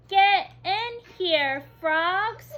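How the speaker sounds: high, loud shout; boy shouting at the frogs; yelling. Acted. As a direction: yell it at the frogs. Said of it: "Zach swings his net and yells".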